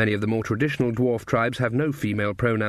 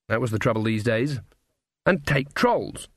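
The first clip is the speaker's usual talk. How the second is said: ordinary delivery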